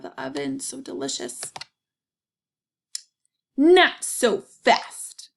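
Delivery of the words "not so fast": loud, slow, higher hen voice; clucking hen. Acted. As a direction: stop them short like a stern hen. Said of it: "clucked the little red hen".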